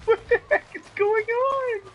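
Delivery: real shock at the screen; baffled. Real, not acted. Baffled by lighting.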